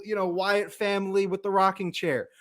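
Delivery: usual speaking voice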